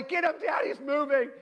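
put-on high-pitched kid-style alarm; mock-frantic urging; imitated urgent shout. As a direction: yell to Dad from the hallway. Kids yell from the hallway.

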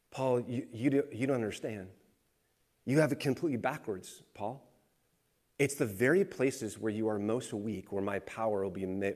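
low, soft and tender; gentle reply. Retold or imitated. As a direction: answer quietly like a loving father. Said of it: "here's God's response to Paul".

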